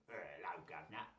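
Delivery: a quiet, lightly done Cockney greeting; Cockney accent. Shown as a demonstration. Trying Orlando's voice in a light Cockney. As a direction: test out a Cockney voice. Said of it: "Orlando will be a Cockney".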